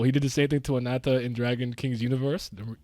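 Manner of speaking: ordinary delivery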